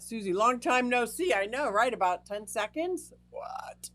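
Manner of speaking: ordinary talk, nothing marked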